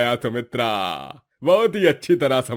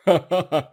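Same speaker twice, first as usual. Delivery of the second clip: evil laugh; 5 semitones higher than usual. Acted. Villain gloats over rivals' misery.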